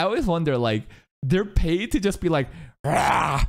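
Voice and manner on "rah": short throaty creature roar; mock dragon cry. Viewer imitating a paid voice actor, mock dragon cry. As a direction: imitate a little monster's cry.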